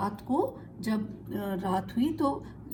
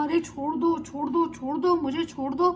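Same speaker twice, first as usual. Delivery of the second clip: frantic pleading, higher and a bit louder; begging; 6 semitones higher, 4 dB louder than usual. Acted. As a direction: beg to be let go while getting beaten. Thief begs while being beaten.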